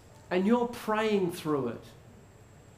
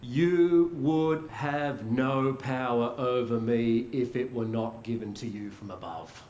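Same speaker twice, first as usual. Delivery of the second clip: Jesus' grave answer; 8 semitones lower than usual. Acted. Jesus answering Pilate's threat, low and steady. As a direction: answer power with steady, low-voiced authority.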